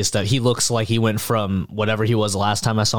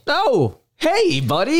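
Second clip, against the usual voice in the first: high-pitched, delighted hello; playing a happy reunion; excited greeting; slower than usual. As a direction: greet your pal with pitch way up. Acted reunion with old friend.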